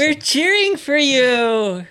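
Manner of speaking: high, drawn-out squad cheer; cheerleader. Pretend. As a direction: call it out like a cheerleader. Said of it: "チアガール的な動きをしてました". (チアガール風に高く伸ばして応援)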